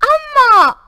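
high, loud drawn-out call of Amma; a beggar wailing loudly at the gate; beggar's wail. A narrator's reading. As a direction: wail it out to the house. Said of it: "came a wail from the gate".

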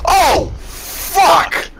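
high, loud scream of rage; sudden howl at being killed; raging gamer. Real, not acted. Sudden death in game.

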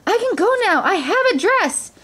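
high, jubilant announcement; excited calling. A narrator's reading. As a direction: call out with breathless hope. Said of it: "she called".

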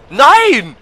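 loud, high "Nein!" of dismay; first cry of alarm, loud and high; alarmed shout. Real, not acted. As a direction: yell at the screen as it goes wrong. Game character about to die.

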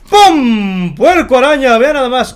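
loud, high announcement; hyped reveal. Real, not acted. Gamer unveils the monstrous spider pig to viewers, hyped. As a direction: burst out with a big showman's reveal.

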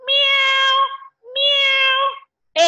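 high mewing meow; cat meow. Shown as a demonstration. Teacher mews like a cat for the children, high. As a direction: mew like a little kitten. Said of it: "A cat mews".

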